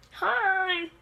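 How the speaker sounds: drawn-out, high-pitched hello; cartoon greeting. Fishy Boopkins turns up to greet everyone, high cartoon hello. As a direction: pop in as a goofy cartoon character.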